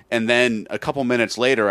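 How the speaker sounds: normal talking voice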